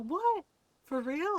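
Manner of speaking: high-pitched retold surprise; astonished. Friend learns she made him two plates, astonished. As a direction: blurt it in mock disbelief.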